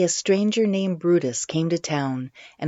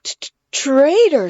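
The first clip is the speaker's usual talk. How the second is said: high, loud, stammering outrage; sputtered; 10 semitones higher, 9 dB louder than usual. A narrator's reading. Brutus sputtering at the girl, high and furious. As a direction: choke out the word in fury. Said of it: "sputtered Brutus".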